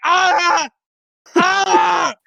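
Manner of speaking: high wailing "ah"; high-pitched kid's scream. Retold or imitated. Crushed child under the timber, high-pitched wail. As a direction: cry out like a hurt little kid. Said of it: "in, like, a high-pitched voice".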